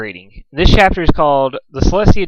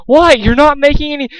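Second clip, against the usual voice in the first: panicked protest; 13 semitones higher, 4 dB louder than usual. A narrator's reading. Dusk panicked confusion.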